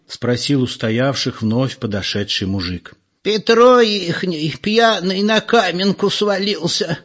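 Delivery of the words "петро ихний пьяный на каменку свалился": put-on high, slow old village granny voice; old woman. A narrator's reading. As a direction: draw it out like an elderly gossip. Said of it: "пояснила какая-то старушка".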